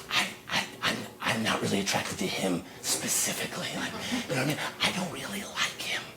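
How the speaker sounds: very soft, halting confession; confidential near-whisper. Pretend. Comic confides his dislike to the audience, hushed near-whisper. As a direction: confide it as a delicate secret.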